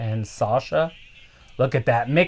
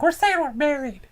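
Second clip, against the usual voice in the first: high mock voice; 14 semitones higher than usual. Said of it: "She's like".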